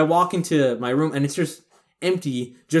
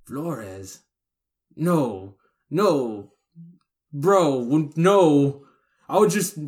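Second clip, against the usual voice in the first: scared; 3 semitones higher, 4 dB louder, much slower than usual. Retold or imitated. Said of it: "he's just looking at me like".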